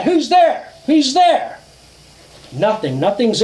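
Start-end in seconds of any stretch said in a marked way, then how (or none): 0.0-1.6 calling out. Retold or imitated. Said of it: "my dad's yelling out".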